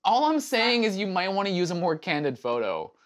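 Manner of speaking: high-pitched, needling know-it-all tone; smarmy. Acted. Sibling advising sister on her dating profile, smarmy. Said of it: "saying it with that smarmy, stupid tone".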